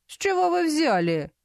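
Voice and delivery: indignant king. A narrator's reading. King suspicious challenge.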